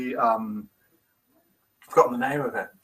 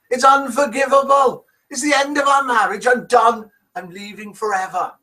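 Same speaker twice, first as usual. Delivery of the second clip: high, hammed-up Irish accent sliding into Welsh; worked-up Irish-Welsh lilt, pitched high; attempted Irish accent gone Welsh; 8 semitones higher, 3 dB louder than usual. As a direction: rage in a bad Irish accent. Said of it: "He's gone Welsh now".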